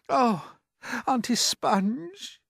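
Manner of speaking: put-on high, pleading child's voice; a little boy begging his aunts; small boy pleading. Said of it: "James cried out".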